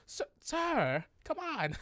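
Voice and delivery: high-pitched goblin whine; pleading goblin. Acted.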